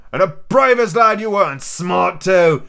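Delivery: pirate cook, hearty. Acted. Silver welcomes the lad into the crew, hearty. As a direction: praise the lad with pirate gusto.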